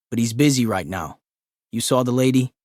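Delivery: office worker voice. Acted. Office man voiced in story.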